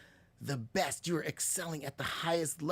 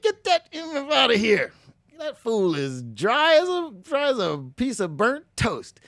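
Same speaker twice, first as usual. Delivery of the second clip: higher and louder, scornful host's brush-off; Speedy dismissing; 6 semitones higher, 4 dB louder than usual. Retold or imitated. Speedy the host throwing out a dull guest, loud and scornful. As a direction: kick the boring guest off the show. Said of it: "Speedy would have been like".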